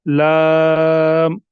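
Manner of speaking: lam stretched long and slow; slow teaching recitation of लाम; drawn-out letter name. Shown as a demonstration. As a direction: recite लाम slowly, holding the vowel. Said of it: "उसी के मुताबिक पढ़ेंगे".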